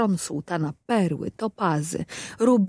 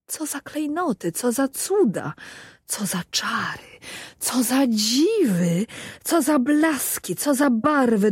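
higher, rapturous exclamations; awed delight; 6 semitones higher than usual. A narrator's reading. (zachwycony, wyżej)

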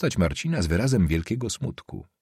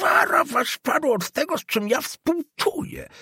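put-on much higher, shrill furious old man; old councillor shouting at the student, much higher and furious; angry old man's shout. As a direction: bark back at the impudent boy. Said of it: "krzyknął stary".

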